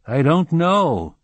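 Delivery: nervous, high, slow and loud. A narrator's reading. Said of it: "said God nervously".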